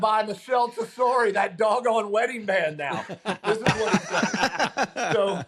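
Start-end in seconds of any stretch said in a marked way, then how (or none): 0.0-3.0 high, loud retold shout; emphatic outburst. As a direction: shout it as an exasperated punchline. Retold outburst of the Masters winner.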